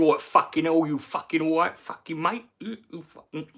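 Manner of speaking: a hint of a rowdy boxer greeting a mate; Nigel Benn impression. Retelling Nigel Benn greeting him, mild mock boxer voice. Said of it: "He had Nigel Benn".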